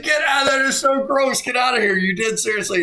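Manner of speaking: high-pitched, retold disbelief; incredulous disbelief. Former player retelling his reaction to a gross confession, incredulous.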